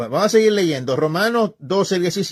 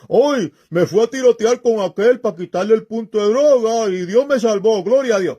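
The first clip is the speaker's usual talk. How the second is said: higher, louder street-tough boast, mocked; mock reggaeton singer; 6 semitones higher, 4 dB louder than usual. Preacher parodying a reggaeton singer's thanks to God, higher, louder and mocking. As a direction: swagger through it like a thug thanking God. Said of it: "será por".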